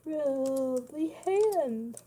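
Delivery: words stretched through a yawn. Real, not acted. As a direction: talk mid-yawn, slowly. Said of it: "I'm sorry I'm yawning".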